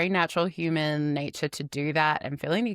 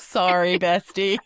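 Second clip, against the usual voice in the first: louder teasing Canadian "Sorry"; mock Canadian accent; 4 dB louder than usual. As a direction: playfully put on a Canadian accent. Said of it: "We can't mock".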